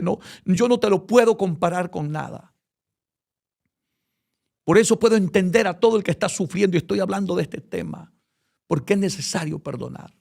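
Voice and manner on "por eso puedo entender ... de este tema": urgent preaching. Acted.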